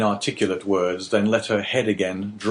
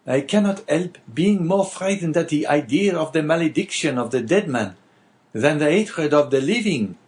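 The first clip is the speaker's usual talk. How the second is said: Caderousse, native tongue; 8 semitones higher, 3 dB louder than usual. Acted. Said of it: "continued Caderousse in his native language".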